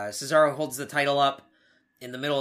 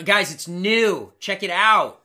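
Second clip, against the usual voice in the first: loud, drawn-out sales pitch, higher pitched; mock hype; 4 semitones higher, 7 dB louder, much slower than usual. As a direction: sell it slowly with sarcastic enthusiasm. Mocking a rehashed show's sales pitch.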